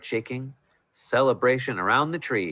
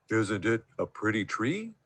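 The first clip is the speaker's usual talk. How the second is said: drowsy yawn; 4 dB quieter than usual. A narrator's reading. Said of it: "yawned Barnaby quite drowsily".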